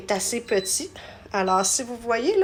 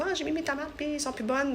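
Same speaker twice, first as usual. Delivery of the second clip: higher-pitched mock complaint; imitating complaining customers; 6 semitones higher, 4 dB quieter than usual. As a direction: voice someone else's complaint, pitched up. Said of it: "il y en a qui disent". (imitation de clients qui se plaignent, voix plus aiguë)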